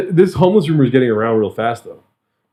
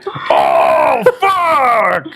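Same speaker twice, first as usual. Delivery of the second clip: high, shouted oh then fuck; startled curse; 5 semitones higher than usual. Real, not acted. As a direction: blurt the curse out in shock. Sudden outburst.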